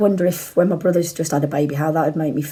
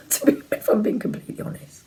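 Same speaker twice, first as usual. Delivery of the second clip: laughing; 4 semitones higher, 5 dB quieter than usual. Real, not acted. Laughing admission.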